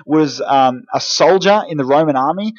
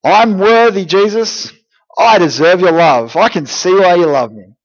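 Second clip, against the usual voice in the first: loud, raised boast in imitation; mock self-righteous; 3 semitones higher, 4 dB louder than usual. Imitating religious people claiming Jesus's love, mock self-righteous. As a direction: act out someone sure they have earned it.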